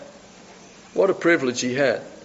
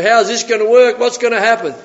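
raised, louder mock fretting; worried questioning; 7 semitones higher, 5 dB louder than usual. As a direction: imitate someone flustered by a problem. Voicing a worried believer.

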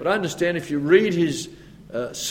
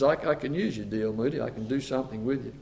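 softer and lower, gentle promise; reassuring God; 4 semitones lower, 8 dB quieter than usual. Retold or imitated. God gently reassuring D L Moody. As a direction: tell him quietly he is enough. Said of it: "God says".

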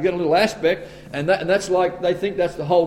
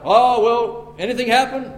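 quoting Wesley; 5 semitones higher than usual. Retold or imitated. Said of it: "and he'd say".

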